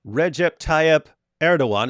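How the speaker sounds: slow, careful Recep Tayyip Erdogan, REH-jep TAH-yip EHR-do-wan; attempted Turkish pronunciation. Shown as a demonstration. Host trying the learned name, careful Recep Tayyip Erdogan. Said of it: "Is that close enough?".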